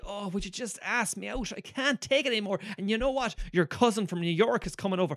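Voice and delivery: high, pleading mock Irish girl, lightly hammed; mock Irish heroine. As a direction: parody a lovesick film heroine. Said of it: "she's like".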